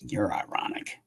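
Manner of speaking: hushed, breathy whisper under his breath. Real, not acted. Streamer whispering an aside to himself, hushed. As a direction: say it barely voiced, to yourself.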